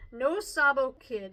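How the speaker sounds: ordinary delivery, nothing marked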